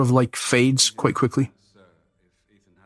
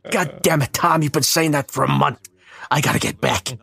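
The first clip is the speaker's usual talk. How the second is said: put-on voice of an exasperated actor; hammed-up annoyed star impression; Henry Cavill impression. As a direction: grumble in a mock movie-star voice. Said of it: "That was my impression of, uh, Henry Cavill".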